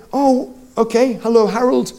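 ordinary delivery

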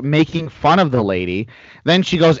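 ordinary delivery

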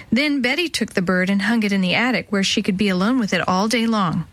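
ordinary delivery, nothing marked

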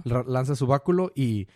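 ordinary talk, nothing marked

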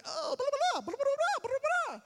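very high, loud rapid babble; ranting host mother. Retold or imitated. Host mother answering toothache complaint, hammed-up shrieking babble. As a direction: imitate her excited foreign speech as gibberish. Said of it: "She's like".

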